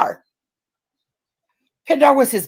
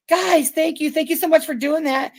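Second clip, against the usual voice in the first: mock sweet thanks; 7 semitones higher than usual. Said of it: "She's like".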